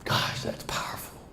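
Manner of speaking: soft unvoiced aside; awed whisper. Real, not acted. Speaker to audience, reacting to the quote in an awed whisper. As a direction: hush it in wonder.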